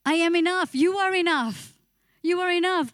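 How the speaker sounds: preacher voices God declaring to the listener; God's promise declared. Acted. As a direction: proclaim it with assurance. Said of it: "God will tell you and say".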